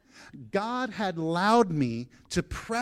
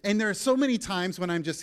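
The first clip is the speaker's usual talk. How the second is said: normal talking voice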